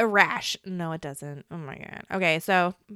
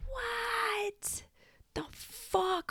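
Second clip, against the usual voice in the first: unvoiced whisper, rising into a high hush; horrified whisper; shocked whisper; 13 semitones higher, 9 dB quieter, much slower than usual. Real, not acted. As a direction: breathe it out in disbelief. Shocked by AI answer.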